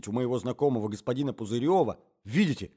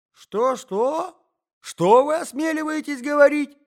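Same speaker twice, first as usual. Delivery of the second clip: put-on high, loud outraged old man; old man yelling in outrage at the insult; old man shouting; 13 semitones higher, 6 dB louder, slower than usual. A narrator's reading. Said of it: "закричал старик".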